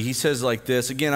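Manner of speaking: ordinary delivery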